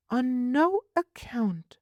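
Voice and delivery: put-on high, prim little girl asking. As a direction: voice a fussy small girl, pitched high. Child voice.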